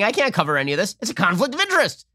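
mocking, high-pitched protest; hammed-up mock indignation; Chris Cuomo. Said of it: "So here is Chris Cuomo last night saying".

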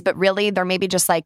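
usual speaking voice

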